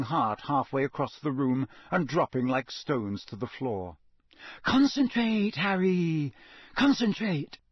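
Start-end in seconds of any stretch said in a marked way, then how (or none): 4.6-7.6 urgent urging. A narrator's reading. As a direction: urge him on hard. Hermione drilling Harry.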